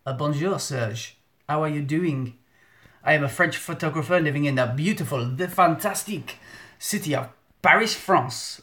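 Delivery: hammed-up French accent; put-on French accent, greeting a friend. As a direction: introduce yourself in a mock French accent. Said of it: "speak in a French accent to him".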